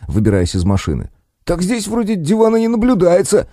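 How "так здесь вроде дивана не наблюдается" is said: much higher snippy retort; snapping back. Acted. Kostik on the ground snaps at the paramedic, snapping. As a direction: snap back at the nurse. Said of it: "огрызнулся Синёв".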